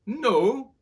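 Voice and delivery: high, loud disbelieving no; Henry can't believe his ears; shocked Henry. A narrator's reading. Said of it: "repeated Henry".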